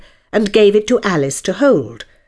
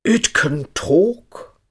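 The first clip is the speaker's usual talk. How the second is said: slow, solemn pronouncement; drawn-out, solemn statement; much slower than usual. A narrator's reading. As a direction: announce it gravely, stretching each word. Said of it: "said Hare solemnly".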